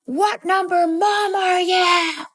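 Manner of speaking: imitated child, high and drawn out; repeated intense demand. Child repeats question to mom, high slow insistent imitation. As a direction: act out a kid insisting on an answer, slowly. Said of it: "I said it again".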